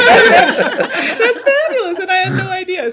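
high, loud, stretched-out laughing delight; gushing delight. Real, not acted. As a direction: squeal it with joy. Gushing over dragon story.

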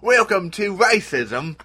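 high, loud mock Aussie greeting; hammed-up Australian welcome; Crocodile Dundee Australian. Greeting newcomers to a lesson, broad mock Australian. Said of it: "I was more Crocodile Dundee there".

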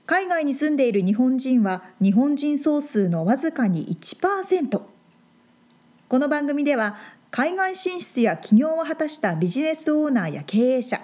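measured, scripted podcast opening narration; polished announcer introduction; programme intro read. Acted. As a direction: read the show intro like an announcer, carefully paced.

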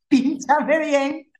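laughing plea. Retold or imitated. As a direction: beg the anesthetist half laughing. Por Dios.

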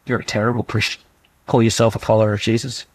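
low, quick, sneering accuser; accusing enemy voice. Acted. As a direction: hiss down at them like the devil accusing.

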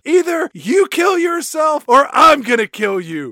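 loud hammed-up threat; over-the-top mock yell. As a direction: shout him back to duty. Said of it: "is like".

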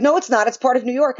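high-pitched mock arguing; mock know-it-all insistence; imitated contradiction. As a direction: argue back up high, mimicking them. Voices people wrongly arguing back.

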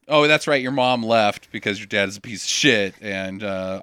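ordinary talk, nothing marked

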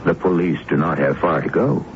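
usual speaking voice

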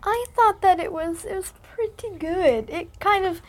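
a hint of an elderly British lady accent; old British lady voice. Daughter slipping into a mild old British lady accent. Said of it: "Why are you talking like that?".

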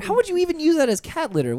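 high-pitched, indignant disbelief; incredulous. Real, not acted. Host objects to cat litter suggestion, incredulous. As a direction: protest as if deeply baffled.

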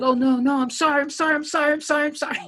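high, panicked sorrys; wimpy apology. Retold or imitated. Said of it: "like, so wimpy about things".

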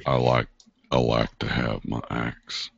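low, slow hillbilly drawl; hillbilly accent, low and menacingly unhurried; scary hillbilly accent. Acted. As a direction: drop into a creepy, unhurried backwoods accent. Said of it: "saying that in that accent is really scary".

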